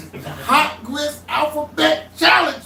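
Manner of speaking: slow, drawn-out hype announcement; game show announcer. Real, not acted. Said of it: "re-announces game name slowly".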